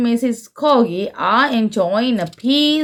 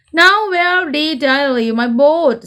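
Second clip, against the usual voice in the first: a light, higher Grandpa Pig puzzling aloud; 8 semitones higher than usual. Acted. Grandpa Pig asking himself where his boat is, lightly voiced. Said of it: "says Grandpa Pig".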